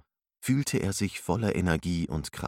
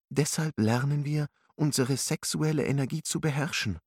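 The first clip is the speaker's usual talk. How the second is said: higher, gentle female character; young woman voice; 8 semitones higher than usual. Acted. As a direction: speak as a kind young teacher. Alice's voice in narration.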